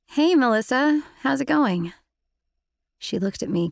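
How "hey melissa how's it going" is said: forced cheer. A narrator's reading. Forced cheerful greeting to crying friend.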